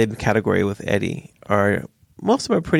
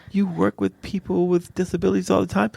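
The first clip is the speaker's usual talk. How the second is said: mock exasperation; 7 semitones higher than usual. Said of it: "I'm like".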